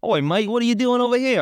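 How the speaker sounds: high mock Australian greeting; a hammed-up Aussie bloke; cartoonish Australian "oi, mate"; Australian accent. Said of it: "Aussies, bro".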